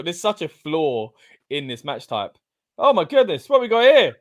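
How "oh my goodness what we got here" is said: high, loud astonished reaction; excited surprise. Real, not acted. Commentator spots someone joining the match, excited surprise. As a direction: exclaim at a surprise arrival.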